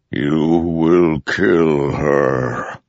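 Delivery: very slow, mournful godlike voice; deep, regretful storm voice. A narrator's reading. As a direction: warn with sorrow, vast and heavy. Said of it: "so deep, so powerful, sounded regretful, mournful".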